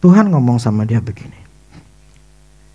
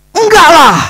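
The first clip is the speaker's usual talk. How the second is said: much higher, louder whine; whiny refusal; 14 semitones higher, 7 dB louder than usual. Retold or imitated. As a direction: whine it out like a child. Refusing God again.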